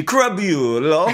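slow, drawn-out Crabulo in a hammed-up Italian accent like Jared Leto in House of Gucci; Jared Leto's Gucci accent. Mocking the name as Jared Leto in House of Gucci, with an Italian accent. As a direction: stretch Crabulo out slowly with a mock Italian lilt. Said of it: "how Jared Leto would say it in the House of Gucci".

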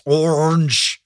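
orange drawn out, its sounds smeared together; bad-sounding orange. Shown as a demonstration. Host showing how bad the word sounds, orange stretched out. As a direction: stretch out orange as a clumsy mouthful. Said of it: "just a bunch of bad sounds".

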